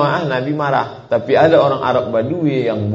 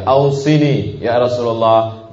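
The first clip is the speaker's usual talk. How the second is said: pleading questioner; 3 semitones higher, 6 dB louder, slower than usual. Acted. Man begging the Prophet.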